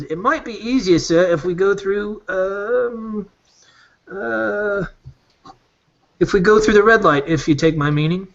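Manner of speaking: sly guide Birdie. Acted. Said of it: "He says".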